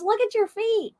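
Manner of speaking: high-pitched mock exclamation; teasing quote. Wife imitating husband calling out about her feet, teasing.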